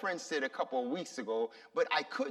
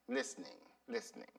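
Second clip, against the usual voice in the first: fading echo; 9 semitones lower, 11 dB quieter, much faster than usual. Real, not acted. Echoed trailing word.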